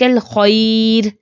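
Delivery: الخیر drawn out very slowly, final ra stopped light; slow recited example; slow recitation. Shown as a demonstration. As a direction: stop on الخیر with a thin ra. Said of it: "ایک اور مثال لے لیں کہ بیدک".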